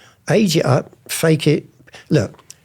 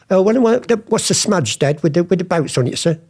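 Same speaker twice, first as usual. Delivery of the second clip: higher-pitched, playing clueless; ignorant dealer act; 6 semitones higher than usual. Son to dad before the auctioneer, feigned ignorance. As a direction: act the ignorant antique dealer asking naively. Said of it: "You talk like that to them".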